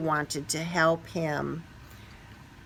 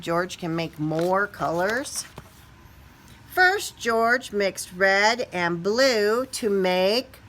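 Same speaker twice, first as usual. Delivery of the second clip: usual speaking voice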